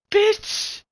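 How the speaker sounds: sudden high, loud shriek; real exasperated high-pitched shout; angry outburst. Real, not acted. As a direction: yell it way up high. Angry outburst at the girl discussed.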